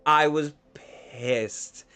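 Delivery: slow, drawn-out mock indignation; dramatic emphasis. Teller recalls kid's insult, slow hammed-up indignation. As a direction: stretch it out for comic effect.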